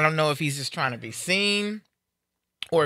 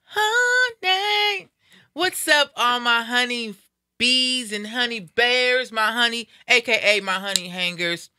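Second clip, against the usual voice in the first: radio host hype greeting; 6 semitones higher, slower than usual. Acted. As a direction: hype up the listeners like a radio announcer opening the show. Host opens show greeting listeners.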